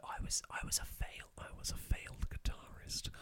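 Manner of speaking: hushed confession, whispered; whispering. Real, not acted. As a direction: whisper it like a guilty secret. Confessing he failed at guitar.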